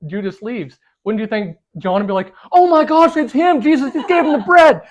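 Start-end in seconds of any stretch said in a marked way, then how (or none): 2.5-4.8 loud, high mock alarm; shocked outburst. John spotting the betrayer, loud shocked outburst. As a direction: blurt out the astonishing discovery.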